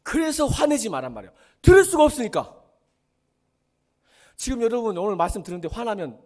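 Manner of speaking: 높고 크게 내지르는 훈계; 목청껏 못박아 말하기; 호통치는 설교. Real, not acted. Preacher shouting at congregation. (큰 소리로 호통치듯)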